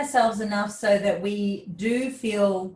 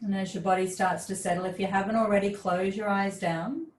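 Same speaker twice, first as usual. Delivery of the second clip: guided relaxation; 2 semitones lower, 3 dB quieter than usual. Real, not acted. Yoga teacher guides class with eyes closed, soft guided relaxation. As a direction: ease them inward, calm and unhurried.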